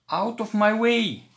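high, loud shout to clear the way; gruff shout. A narrator's reading. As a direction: bark it at the little mice. Busy street, someone shouts.

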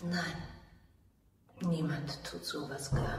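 soft, low murmured agreement; quiet sorrowful agreement at the bedside; hushed murmur. Acted. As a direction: barely breathe the words back to her. Quiet reply to dying lady.

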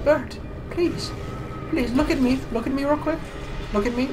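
high, pleading calls. Real, not acted. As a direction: beg him to look. Begging for attention.

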